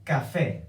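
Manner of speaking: "café" stressed on FÉ; stress on last syllable. Shown as a demonstration. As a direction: lean on the final FÉ of "café". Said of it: "how to make the stress of the word".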